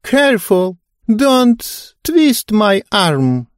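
put-on high, slow whiny old lady; capricious old lady. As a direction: whine it like a fussy old woman. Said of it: "закапризничала она".

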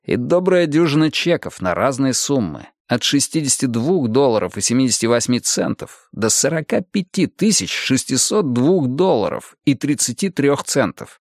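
usual speaking voice

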